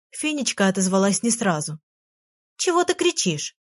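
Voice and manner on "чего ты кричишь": high, light fairy voice, calmly asking; lightly voiced little fairy, unbothered. A narrator's reading. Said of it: "спокойно спросила". (лёгкий высокий голосок феи, спокойно)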